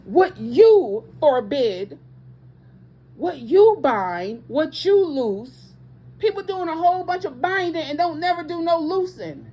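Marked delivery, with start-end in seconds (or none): 0.1-5.7 slow, weighty quote of Jesus; each phrase laid down slowly, hitting you; quoting Jesus. Retold or imitated. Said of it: "when he says".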